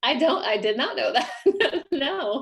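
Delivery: real laugh of surprise. Real, not acted. Author learning from host she started the group, laughing surprise.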